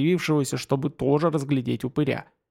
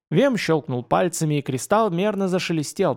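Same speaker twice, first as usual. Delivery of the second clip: plain reading voice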